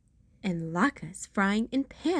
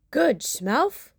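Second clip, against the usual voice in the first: chuckling Santa; 2 semitones higher, 4 dB louder than usual. Acted. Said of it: "chuckled Santa".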